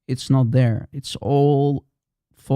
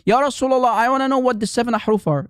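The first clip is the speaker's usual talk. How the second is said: higher, quick insistent plea; eager questioner; 8 semitones higher, faster than usual. Acted. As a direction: press the question like a keen follower. Voicing the insistent questioner again.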